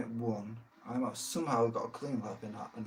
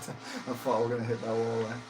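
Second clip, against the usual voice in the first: ordinary talk, nothing marked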